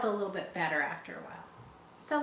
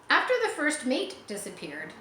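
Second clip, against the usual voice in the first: plain reading voice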